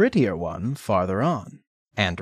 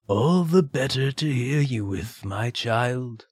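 wolf as grandmother; 3 semitones higher than usual. Acted.

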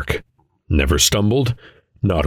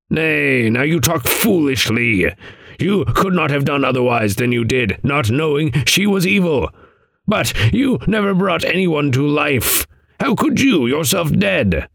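higher, stern, riddling elder; stern old man voice; 8 semitones higher than usual. Acted. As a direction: chide him like a wise old sage. Adam rebukes Vane.

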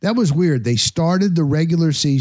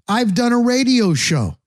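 ordinary talk, nothing marked

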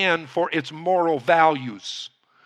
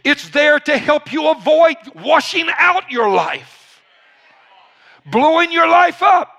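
raised, slow, emphatic sermon delivery; full-force preaching; 8 semitones higher, 4 dB louder than usual. Real, not acted. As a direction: preach it hard, drawing out the key words.

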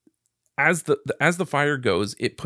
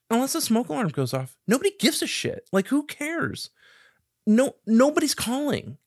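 dismissive; 11 semitones higher than usual. Retold or imitated. Brushing off his panicked mom.

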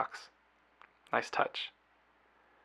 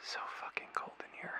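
whispering; 14 dB quieter than usual. Acted. Alone in freezing apartment.

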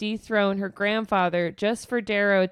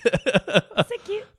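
gushing; 7 semitones higher, 3 dB louder, slower than usual. Real, not acted. Gushing reaction.

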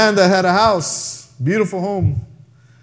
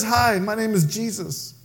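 gentle Jesus; 5 semitones lower than usual. Retold or imitated. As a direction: offer help tenderly to a broken man. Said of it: "comes up to him and says".

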